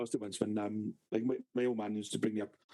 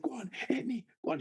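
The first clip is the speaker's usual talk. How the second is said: retold shriek, pitched up at normal volume; mock screaming taunt; 12 semitones higher than usual. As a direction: imitate a screaming sister daring you. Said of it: "screaming in my face".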